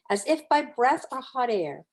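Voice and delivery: ordinary delivery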